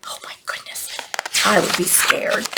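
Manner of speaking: hushed, awed whisper; barely voiced mock alarm; whispered aside. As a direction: whisper it to the children. Aside after troll's threat.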